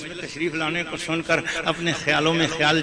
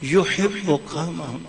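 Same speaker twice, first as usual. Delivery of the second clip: slow recitation; much slower than usual. Retold or imitated. Preacher quoting a companion's question, slow hushed recitation. Quotes Arabic hadith slowly.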